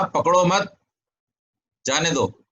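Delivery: "पकड़ो मत" said together, pause, then "जाने दो"; pause after मत. Shown as a demonstration. Teacher quotes someone's instruction, with the pause after मत. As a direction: break clearly after मत, before जाने दो. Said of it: "किसी ने कहा".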